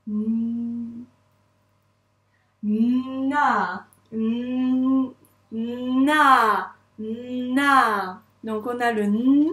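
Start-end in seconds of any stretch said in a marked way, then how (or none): 0.1-8.2 NA with a long hummed n and held a; syllable demo. Shown as a demonstration. Teacher on the first syllable only, drawn-out NA. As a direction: hum the N, then open into NA. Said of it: "que de la première syllabe".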